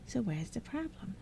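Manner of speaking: the first goblin fed up with Sarah; impatient sigh. Acted. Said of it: "with an impatient sigh".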